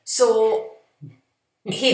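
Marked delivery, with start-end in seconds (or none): none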